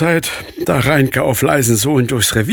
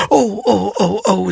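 put-on a touch of a child's sighing oh; mild worried sighs, higher at first then sinking; 5 semitones higher than usual. Little Nele sighs over the lost hen, mild sighing. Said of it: "seufzte Nele".

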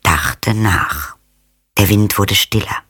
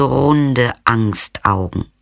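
ordinary delivery

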